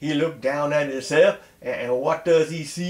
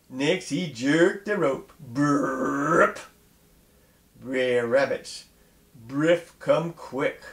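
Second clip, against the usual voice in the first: ordinary delivery, nothing marked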